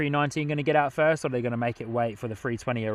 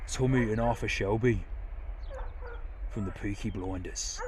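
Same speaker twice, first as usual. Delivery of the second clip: mock Birmingham accent, lower and softer; playful Brummie accent; Brummy accent; 4 semitones lower, 9 dB quieter than usual. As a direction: put on a light Brummy voice. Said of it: "What do you guys reckon to my Brummy".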